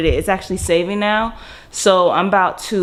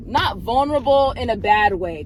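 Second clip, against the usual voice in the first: ordinary talk, nothing marked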